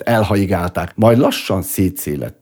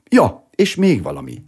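offhand add-on, pitched up; casual afterthought; 9 semitones higher than usual. A narrator's reading. Presenter adding one more thing to the audience, casually. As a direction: toss it in as if just remembered. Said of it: "mintha csak mellesleg".

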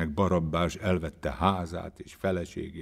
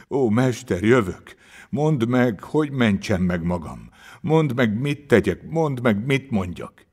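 loud, higher-pitched urgent pleading; tearful, desperate cry; 5 semitones higher, 7 dB louder than usual. A narrator's reading. Sufferer begs the master to tell him what to do, crying out. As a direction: implore loudly, each plea leaping up. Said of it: "kiáltotta elfulladva és könnyek között".

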